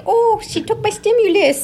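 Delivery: high mocking whine; mock whiny ex. Ex-wife mimicked complaining about stimulus, high mock whine. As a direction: imitate her complaint, high-pitched.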